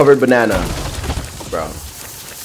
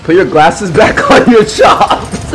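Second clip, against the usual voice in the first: laughing through words; 7 semitones higher, slower than usual. Real, not acted. Cracking up.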